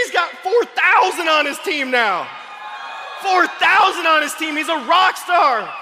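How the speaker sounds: high, loud, slow hype shout; drawn-out triumphant announcing; excited hype. Real, not acted. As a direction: trumpet the big number. Hyping the friend's success.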